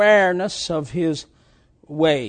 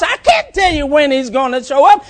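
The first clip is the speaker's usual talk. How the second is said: shouted, rising preacher's cry; fiery preaching; 10 semitones higher, 11 dB louder than usual. Acted. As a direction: preach it out loud to the congregation. Preaching at full force.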